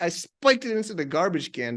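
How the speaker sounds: ordinary talk, nothing marked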